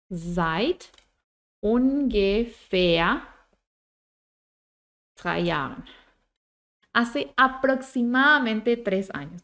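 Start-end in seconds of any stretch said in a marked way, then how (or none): 0.1-5.8 slow dictation. Shown as a demonstration. Teacher modelling an answer for learners, slow dictation. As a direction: piece by piece, with long pauses.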